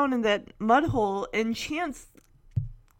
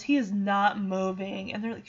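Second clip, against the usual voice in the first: ordinary delivery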